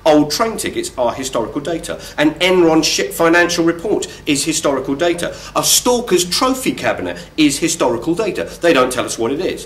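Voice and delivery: ordinary delivery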